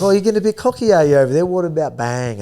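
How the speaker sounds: hammed-up playful jab; lightly mocking a cocky opponent; mock taunt. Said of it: "he's like".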